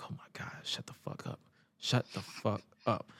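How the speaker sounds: whispered plea under the breath; hushed, fed-up aside; exasperated whisper. Retold or imitated. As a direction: whisper it in weary disbelief. Muttered aside at endless awkward speech.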